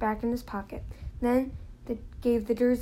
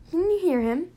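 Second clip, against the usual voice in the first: indignant; 6 semitones higher, 5 dB louder than usual. A narrator's reading. Mr Weasley indignant at Dursleys.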